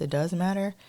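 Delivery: normal talking voice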